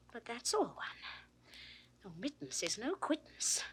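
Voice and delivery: usual speaking voice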